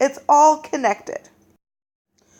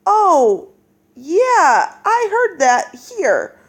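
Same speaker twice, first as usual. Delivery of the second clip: higher, louder, slower excited aha; excited realization; 6 semitones higher, 3 dB louder, much slower than usual. Retold or imitated. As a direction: act out a reader's sudden recognition, a bit louder. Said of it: "then we go".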